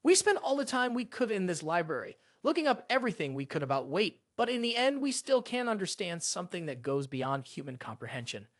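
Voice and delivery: usual speaking voice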